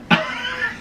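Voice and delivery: squealing giggle; high squeaky laugh. Real, not acted. Still laughing at the joke.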